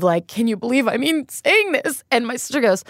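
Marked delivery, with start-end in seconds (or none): 0.3-2.0 lightly hammed-up, higher-pitched; mock the drama of the confession; retold dramatic. Retelling her confession to sister, mildly dramatic. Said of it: "I said it so dramatically".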